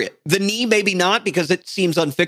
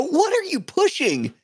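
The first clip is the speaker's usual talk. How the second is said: outraged disbelief; 13 semitones higher than usual. Real, not acted. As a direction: squeak out the outrage. Mock outrage.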